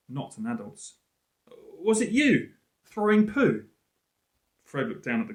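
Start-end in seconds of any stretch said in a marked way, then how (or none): 1.5-3.7 put-on high, slow, indignant child; a child asking with a wrinkled nose; child's voice. Girl asks Fred about the thrown dung, high childish voice. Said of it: "the voice was definitely a child's".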